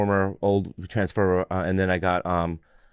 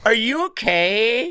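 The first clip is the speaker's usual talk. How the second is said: high-pitched, drawn-out mock concern; high, slow concerned girl; 12 semitones higher, much slower than usual. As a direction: stretch it out in a high girly voice. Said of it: "No, they'd be like".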